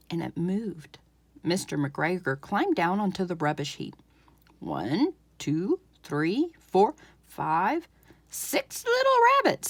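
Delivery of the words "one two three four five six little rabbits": lightly voiced old farmer, rising to a high gleeful finish; gruff farmer counting. Farmer counts caught rabbits.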